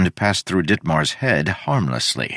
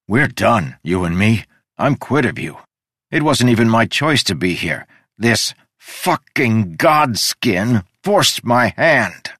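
angry; 5 semitones higher, 3 dB louder than usual. Acted. Udo rages at the king.

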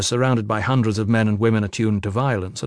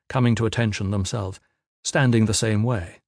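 usual speaking voice

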